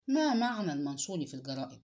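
usual speaking voice

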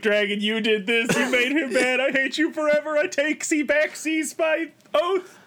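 rising high and anguished; dramatic vow. Retold or imitated. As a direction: swear it with rising fury. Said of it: "is like".

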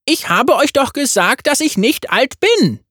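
high, cheeky yordle voice; playful little gunner; Tristana voice. A narrator's reading.

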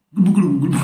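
fish bubbling noise. Pretend. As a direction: mimic a fish bubbling underwater. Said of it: "Suara ikan".

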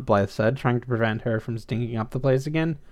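ordinary delivery

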